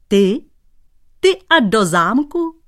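high, loud laughing disbelief; mocking laugh. Shoemaker laughing at the painter going to the castle, high and loud. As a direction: cackle at the idea. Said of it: "Smál se, až ochraptěl".

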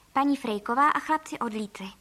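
ordinary delivery, nothing marked

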